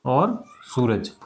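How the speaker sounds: चांद और सूरज drawn out very slowly; slow dictation. Shown as a demonstration. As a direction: spell it out for children to copy. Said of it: "चांद और सूरज हो जाएगा ये". (धीरे-धीरे लिखवाना)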